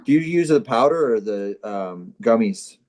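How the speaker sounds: usual speaking voice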